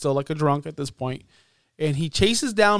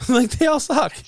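laughing; 8 semitones higher than usual. Real, not acted. Repeated with laughter.

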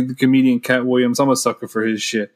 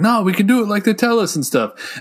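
mock naive voice; 9 semitones higher than usual. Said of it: "he was like".

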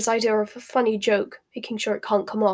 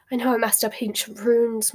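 plain reading voice

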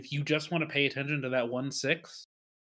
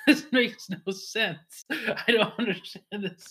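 confused whine; 6 semitones higher than usual. Retold or imitated. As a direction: plead like you're lost. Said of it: "I was like".

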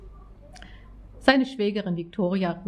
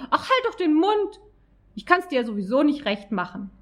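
angry retort; 8 semitones higher than usual. A narrator's reading. Acted quarrel, Jessica.

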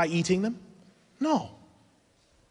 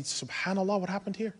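higher, much softer amazed aside; hushed astonishment; 4 semitones higher, 7 dB quieter than usual. Retold or imitated. Parent marvelling at the child's change, hushed astonishment. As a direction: imitate quiet, high-pitched wonder.

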